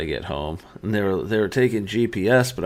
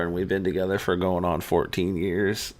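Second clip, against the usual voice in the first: usual speaking voice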